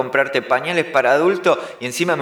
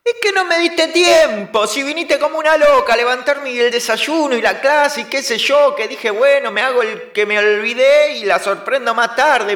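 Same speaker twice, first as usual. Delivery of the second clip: high excited excuses; 9 semitones higher than usual. Acted. Excuses to angry sister.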